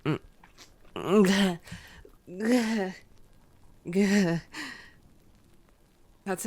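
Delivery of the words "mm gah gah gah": retching gah while forcing it down; gagging. Acted. Vampire to hunter, gagging on bagged blood. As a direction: choke on the taste.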